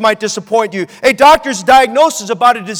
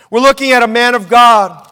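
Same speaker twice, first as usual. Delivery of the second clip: ordinary talk, nothing marked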